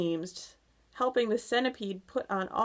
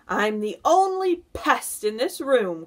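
loud, higher-pitched boastful cry; centipede's cry; 5 semitones higher, 7 dB louder than usual. A narrator's reading. Centipede cheekily teasing the others, a loud cry. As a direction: cry it out with punch on the key words. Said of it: "cried the centipede".

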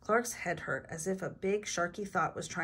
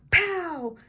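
loud, high action-word bursts; hammed-up exclamations, climbing higher; battle play; 12 semitones higher, 9 dB louder, much slower than usual. A narrator's reading. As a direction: punch out each sound word like a toy battle. Acting out toy battle.

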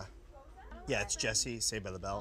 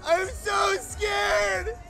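high, very slow frantic shriek; panicked shriek; much slower than usual. Acted. Sitcom meltdown parody, repeated.

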